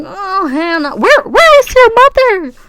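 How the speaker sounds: high, loud, slow outraged where is your mother; mock scolding auntie. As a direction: play the shocked scolding adult. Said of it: "I'm like".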